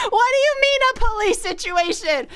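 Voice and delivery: high, loud disbelief; shocked demand for answers; high, loud incredulous question. Retold or imitated. Incredulous exclamation.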